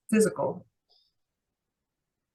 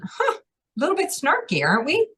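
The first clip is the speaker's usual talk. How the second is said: high, teasing snark; snarky ego; 9 semitones higher than usual. Acted. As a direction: tease in a much higher voice, mock-offended ego. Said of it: "This one's ego is saying".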